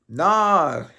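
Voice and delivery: put-on short Italian-accented nah; a light Italian accent. Imitating Marvin Vettori's tweet with a light Italian accent. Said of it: "I'll do this in a Marvin Vettori accent".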